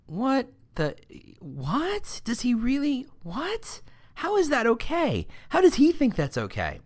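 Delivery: high, incredulous squeaks of shock; stunned disbelief. Retold or imitated. Coworkers staring at each other in disbelief, high and incredulous. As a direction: gasp it out like you can't believe your eyes. Coworkers react to boss's porn surfing.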